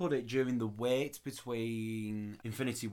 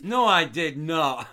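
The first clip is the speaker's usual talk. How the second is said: laughing denial; 7 dB louder, slower than usual. Real, not acted.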